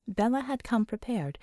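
ordinary delivery, nothing marked

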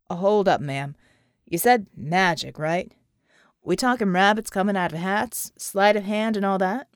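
gruff special ops man doubting magic; skeptical soldier. Acted. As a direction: challenge her, unconvinced. John, soldier, skeptical reply.

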